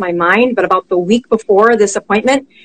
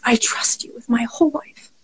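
whispered, close to tears; choked whisper; 9 dB quieter than usual. Retold or imitated. Mother whispering her trust to her daughter, tearful. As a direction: barely breathe it out, moved and trusting.

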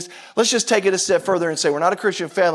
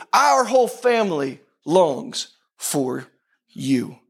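slow, spaced-out psalm recitation; prayerful declaration; much slower than usual. Shown as a demonstration. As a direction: model the prayer for the congregation, drawing out each phrase. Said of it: "I want you to say it like this".

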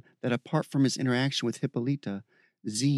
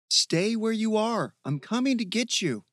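calling out; 10 semitones higher, 3 dB louder than usual. Acted. Michael reassuring distant Bear, calling out. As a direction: call it out long across the forest.

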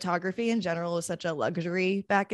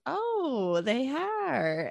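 mock surprised old man; 8 semitones higher, much slower than usual. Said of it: "And he's like".

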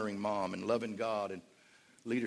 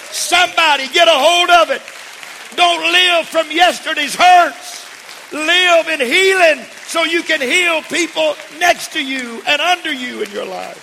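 loud, high, fervent preaching; preaching at full force; 19 dB louder, slower than usual. Acted. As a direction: preach it to the rafters.

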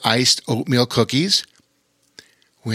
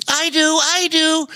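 high eager repeated cry; excited otter; 3 dB louder than usual. A narrator's reading. Bobo Chico wanting lunch, high excited voice. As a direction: jump at the offer of lunch. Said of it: "exclaimed Bobo Chico".

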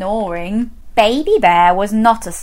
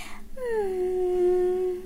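tiny sigh; 9 semitones higher, 10 dB quieter than usual. Acted. Baby bear sighs.

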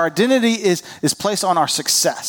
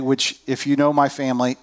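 ordinary talk

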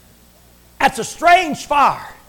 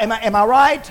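ordinary talk, nothing marked

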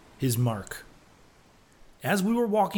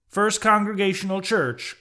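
raised, louder incredulous exclamation; Bildad's outcry; 8 semitones higher, 5 dB louder than usual. A narrator's reading. Old captain echoing a church name in disbelief, crying out. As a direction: cry it out high and loud. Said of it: "cried Bildad".

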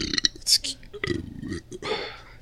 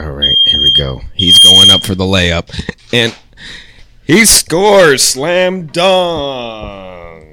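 frantic mock sports commentary; 3 semitones higher, 9 dB louder, much slower than usual. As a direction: call it like a buzzer beater. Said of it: "I narrated the whole thing".

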